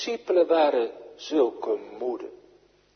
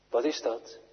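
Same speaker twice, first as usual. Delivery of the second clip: ordinary talk, nothing marked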